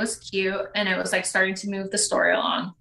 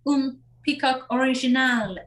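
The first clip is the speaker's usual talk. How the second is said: French-language announcement, lightly imitated; mock French pronunciation of the title; French accent; 3 semitones higher than usual. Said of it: "Why did I set this to French?".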